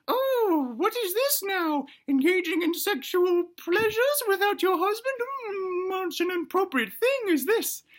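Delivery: very high, shocked falsetto granny; prim put-on granny voice, lingering on the outrage; disapproving granny. Granny catches Mama alone on the bed, disapproving. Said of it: "And Granny is like".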